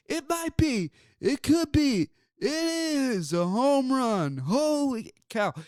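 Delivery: high, drawn-out announcer call; bad Harry Caray impression. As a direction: belt it like an old-school ballpark announcer. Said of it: "the worst Harry Carey impression you are going to hear".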